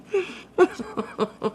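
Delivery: a real burst of laughter at the clip; breathy, falling laugh at the clip. Real, not acted. Streamer laughing at the clip, falling laugh.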